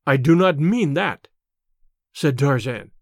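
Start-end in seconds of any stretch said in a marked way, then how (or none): none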